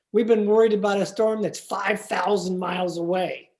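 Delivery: ordinary talk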